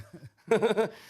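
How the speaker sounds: short hearty laugh. Real, not acted. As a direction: burst out laughing at yourself. Laughs at own cunning. (risada curta e gostosa)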